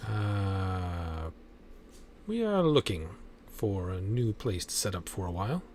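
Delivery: soft, measured in-character answer; guarded negotiator. Acted. Game character explaining the move to players, guarded.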